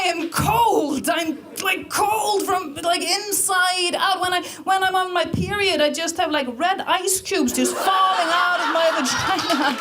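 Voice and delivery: high, intense comic outburst; mock fierce tirade. Icelandic tourist warning off a chatty clerk, high mock-fierce rant. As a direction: declare it like a worked-up Viking.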